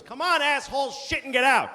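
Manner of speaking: impatient bar worker shouting; barking at the door. Retold or imitated. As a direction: bang and yell at the guy in the bathroom. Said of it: "he bangs on the door and he goes".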